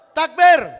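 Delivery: loud, high rallying cry; preacher's rallying shout; takbir call. Real, not acted. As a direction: rouse the crowd to answer.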